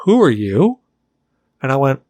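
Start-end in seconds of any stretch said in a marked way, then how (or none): 0.0-0.8 higher, rising question as the short guy; lightly voiced guy questioning a stranger; imitated short guy. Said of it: "he's looking up and he goes".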